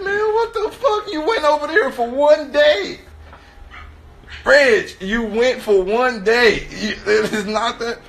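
high, loud disbelief; exasperated scolding. Retold or imitated. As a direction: scold a friend in comic outrage.

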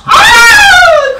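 sharp gasp into the ice; real gasp from freezing water; cold shock gasp. Real, not acted. Gasp entering ice bath.

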